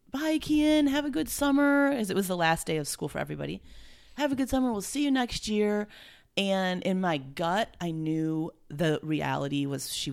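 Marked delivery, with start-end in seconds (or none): 0.1-1.9 friends calling goodbye. Retold or imitated. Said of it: "friends are like". 4.2-5.9 friends calling goodbye. Retold or imitated. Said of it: "friends are like".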